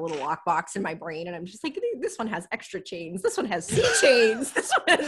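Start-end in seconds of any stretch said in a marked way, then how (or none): 1.7-5.1 rising, laughing list. Retold or imitated. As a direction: giggle through the list, building. Laughing while miming the lockbox.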